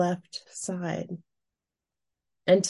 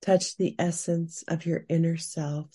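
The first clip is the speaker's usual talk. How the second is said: guided meditation. Acted. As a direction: guide the listener gently into calm. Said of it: "Now we will move into our guided meditation".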